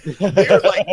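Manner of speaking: slow, loud, laughing through the words; breaking into a loud laugh while talking. Real, not acted. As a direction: crack up mid-sentence and stretch it out. Laughing while about to quote.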